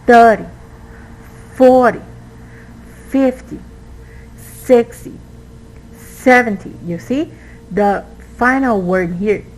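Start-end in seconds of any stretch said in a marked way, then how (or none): 0.1-6.7 THIRty, FORty, FIFty, SIXty, SEVenty, first syllable punched high; stress on first syllable. Shown as a demonstration. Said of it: "we will emphasize the first syllable".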